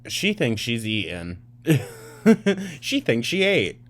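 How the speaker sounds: laughing jeer, jumping high; amused, high-pitched ridicule; mocking laughter. Real, not acted. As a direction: break into a real laugh as you mock her.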